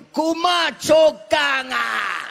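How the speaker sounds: very high, loud drawn-out holler; piercing auntie yell, last syllable stretched; auntie calling out. Retold or imitated. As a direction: holler a name after someone running off. Auntie hollers after comedian across street.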